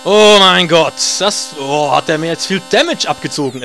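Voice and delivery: normal talking voice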